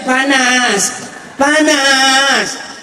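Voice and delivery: drawn-out, falling wail; imitating a mother calling out in the heat; mother's drawn-out cry of heat. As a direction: stretch each word out like a complaining cry. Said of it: "Sampai emaknya teriak".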